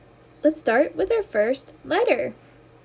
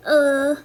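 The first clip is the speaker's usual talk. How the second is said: sick belly groan; 4 dB louder than usual. Shown as a demonstration. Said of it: "Like the boy with the sick belly".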